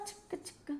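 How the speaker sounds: whispered chug-chug like a toy train; train chugging noise. Pretend. Teacher joking to students, a whispered toy-train chug. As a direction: mimic a little train puffing, breathy and soft.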